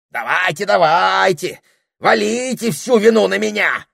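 high, loud, indignant yell; the parrot shouting back at the snake. Acted. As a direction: shout it like he's been wronged. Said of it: "закричал попугай".

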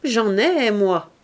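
triomphe. A narrator's reading. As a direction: announce it in triumph. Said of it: "répondit-elle d'un air de triomphe". (réponse triomphante, voix forte et haute)

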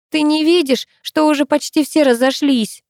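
high-pitched impatient retort; understated cross question; mild exasperation. A narrator's reading. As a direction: snap lightly with impatience. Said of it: "рассердилась Рина".

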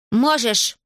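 a mild commanding cry; shouted retort. A narrator's reading. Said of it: "Аделаида крикнула". (сдержанный крик, повыше)

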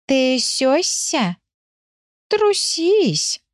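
high, drawn-out taunt; sleepy teasing animal. A narrator's reading. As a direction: drag out each word in a high voice. Sleepy animal taunts trembling dog. (заспанно дразнит, тянет высоко)

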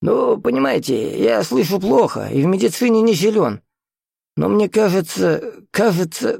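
put-on flustered old host, stalling; agitated old man. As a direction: hedge nervously, dragging out the hesitations.

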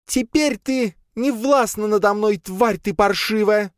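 high, slow cry of defiance; defiant shout. A narrator's reading. Mistress of shadows defies her tormentor, shouting into the air. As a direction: shout it into the air, drawing out each word. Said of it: "крикнула она в воздух".

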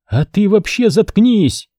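high-pitched angry cry at moderate volume; contained yell, slow and high; acted scream. A narrator's reading. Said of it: "закричала женщина".

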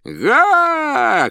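very high, loud shout over the roar; loud clown shout. A narrator's reading. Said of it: "крикнул он, стараясь перекричать рёв". (громкий клоунский выкрик)

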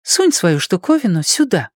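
high female voice giving directions; businesslike girl. A narrator's reading. Ann directs Blaise, high and businesslike. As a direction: instruct matter-of-factly, pitched high. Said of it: "Она деловито указала".

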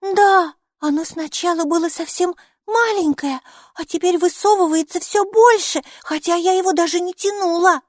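put-on high, loud little-girl voice; excited child. A narrator's reading. Майки feels inside the pocket, high excited child voice. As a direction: describe it in a high, eager child's voice.

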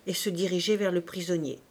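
usual speaking voice

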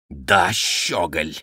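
slow, drawn-out insult; contemptuous. A narrator's reading. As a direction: spit contempt slowly at a rival. Count sneering before a challenge. (презрительно, с растяжкой)

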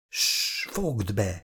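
whispered shush, then a soft hushed order; quiet scolding whisper. Acted. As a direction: hush him so no one hears. Hushing him in bed.

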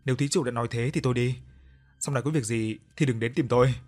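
usual speaking voice

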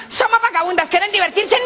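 high squawky parrot voice; shrill little bird calling out; cheeky parrot. Acted. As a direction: chirp it like a saucy parrot.

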